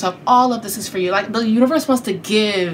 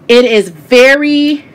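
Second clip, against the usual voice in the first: dramatic reveal; 2 semitones higher, 8 dB louder, much slower than usual. Real, not acted.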